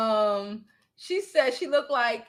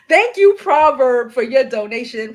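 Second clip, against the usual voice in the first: excited gratitude; 9 dB louder than usual. Real, not acted. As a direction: belt out thanks to the donor. Thanks a donor by name.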